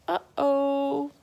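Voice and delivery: high playful uh-oh; playful mock alarm. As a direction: tease like something naughty happened. Mock dismay at the mess.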